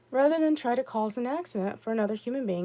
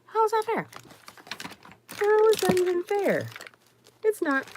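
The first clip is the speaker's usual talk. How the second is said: indignant; 10 semitones higher than usual. Real, not acted. Rhetorical outrage.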